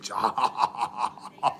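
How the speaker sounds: whispered laugh. Retold or imitated. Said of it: "He's like".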